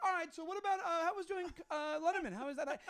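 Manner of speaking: mock host voice, high and chirpy; awkward host. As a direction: cover the awkward silence with fake cheer. Host changing topic awkwardly.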